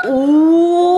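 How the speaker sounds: excited gasp. Real, not acted. Seeing the donuts.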